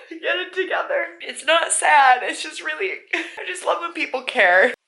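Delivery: high-pitched, choked-up voice; really emotional. Real, not acted. Reader pulling herself together, emotional high voice. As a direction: struggle to get it together. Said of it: "I'm really emotional right now".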